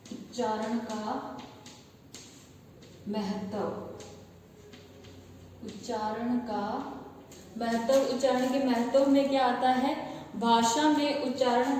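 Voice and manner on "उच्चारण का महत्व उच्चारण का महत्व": slow, spaced-out dictation of a heading; slow board-writing dictation. Shown as a demonstration. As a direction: spell it out word by word as students copy. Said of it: "dictating the heading slowly".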